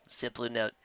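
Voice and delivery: usual speaking voice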